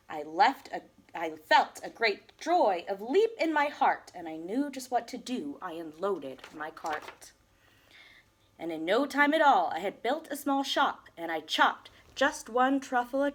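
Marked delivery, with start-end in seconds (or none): none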